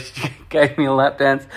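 ordinary delivery

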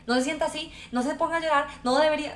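mock admonishing. Parent to a child who is about to cry, admonishing. Mimics adults scolding crying children.

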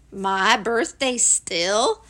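slow, doleful question; ponderous donkey voice; disbelieving gloomy Eeyore. Acted. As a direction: drag it out doubtfully.

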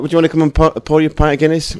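fast, loud, lightly put-on Northern Irish voice; imitating a Northern Irish accent. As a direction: mimic the friendly Northern Irish guide at the Guinness factory. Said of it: "why am I talking like that?".

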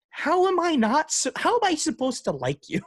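high, incredulous protest; exasperated disbelief. Acted. Fan to Ferrari after another dumb move, exasperated disbelief.

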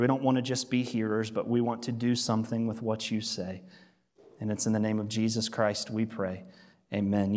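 earnest prayer. Real, not acted. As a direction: pray softly and sincerely over the room.

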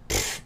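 low scoffing puff of air; dismissive pfft. Acted. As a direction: brush it off with a short puff. Elric dismisses the captain's prophecy.

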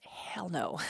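whispered, emphatic no; mock horror whispered aside; whispered refusal. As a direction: hiss it out as a private verdict. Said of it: "I'm like".

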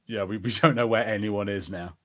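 ordinary delivery

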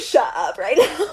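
high, laughing mock order; laughing mock outburst. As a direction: snap it out then burst out laughing. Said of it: "I was like".

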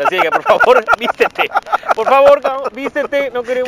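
mock order.

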